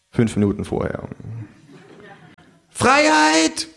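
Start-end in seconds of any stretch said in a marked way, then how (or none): 2.7-3.7 high kangaroo cry; kangaroo proclaiming. Acted. Kangaroo climbing the counter, high proclaiming cry. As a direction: announce it to the whole bar. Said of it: "ruft das Känguru".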